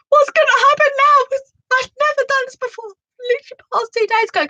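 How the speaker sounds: high, loud panicked pleading; panicky. Retold or imitated. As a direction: ask in a rising panic, fast at the end. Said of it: "I was all panicky".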